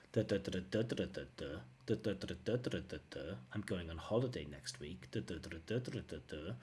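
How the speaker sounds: choppy, separate even beats, quiet; staccato rhythm. Shown as a demonstration. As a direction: tap out a mechanical, unlinked rhythm. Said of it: "staccato or mechanical pronunciation".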